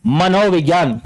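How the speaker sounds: usual speaking voice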